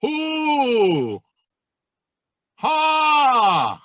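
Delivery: high ringing call from the back of the mouth; practice shout. Shown as a demonstration. Teacher demonstrating shouts to students, high back-placed call. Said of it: "a couple of shouts".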